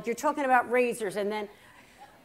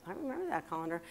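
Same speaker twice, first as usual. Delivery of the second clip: softer, lower private realization; thinking to oneself; 5 semitones lower, 9 dB quieter, much faster than usual. Retold or imitated. Daughter starting to think about the colander, quiet musing. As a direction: drop it down as a musing aside. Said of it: "And I'm starting to think".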